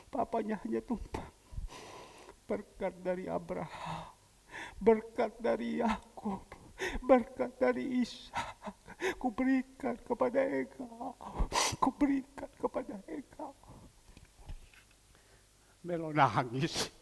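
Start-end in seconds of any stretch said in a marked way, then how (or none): 2.5-13.6 measured, rising benediction; solemn blessing. Retold or imitated. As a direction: pronounce it like a ceremonial blessing.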